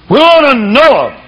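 "We want to know 'em" shouted high and loud; preached shout. Acted. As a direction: hurl "want" out at the congregation. Quoting the mob again, shouted.

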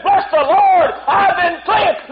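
high, loud, slow shout of praise. Retold or imitated. Cleansed man telling everyone, high and loud. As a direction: shout it, overjoyed. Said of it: "this fellow said".

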